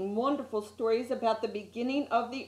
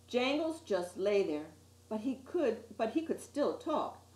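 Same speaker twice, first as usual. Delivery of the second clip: ordinary delivery, nothing marked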